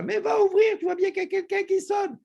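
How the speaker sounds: high, loud yell from another room; aunt yelling. Retold or imitated. Aunt yells from the bathroom to answer the door, high and loud. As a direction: shout it through the bathroom door. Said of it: "ma tante qui crie de la salle de bain".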